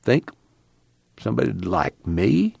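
soft, hesitant question; hushed, tentative asking. A narrator's reading. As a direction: ask it softly, unsure of the answer. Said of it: "one-eyed man said softly".

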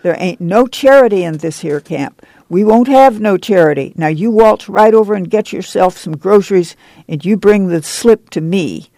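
ordinary delivery, nothing marked

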